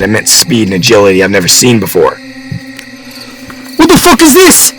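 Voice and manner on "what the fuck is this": very high horrified scream. A narrator's reading. Hunter faces the demonic creature, high scream. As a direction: shriek it in disbelief. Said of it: "I screamed".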